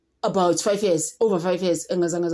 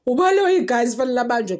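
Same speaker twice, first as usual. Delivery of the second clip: much higher, louder indignant cry; excited outburst; 7 semitones higher, 6 dB louder than usual. Real, not acted. Vlogger to viewers about Baloyi, high indignant outburst. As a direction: burst out demanding he be arrested.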